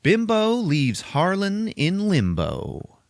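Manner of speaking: slow, high, drawn-out holler; shouting after him. Acted. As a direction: call it out behind him like a headline. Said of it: "she just shouts out behind him".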